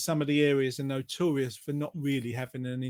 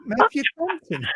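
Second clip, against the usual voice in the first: put-on Welsh accent; 10 semitones higher, 4 dB louder than usual. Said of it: "In a lovely Welsh accent".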